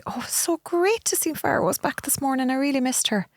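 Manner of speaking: high sweet fan voice; sugary online follower gushing. Retold or imitated. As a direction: coo it like a fond follower. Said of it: "they'll say".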